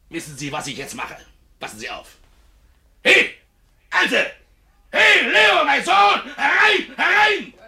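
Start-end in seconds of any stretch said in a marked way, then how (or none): 3.0-7.5 bellowing for the family; shouting. Acted. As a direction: yell for them at full volume. Calling family loudly across yard.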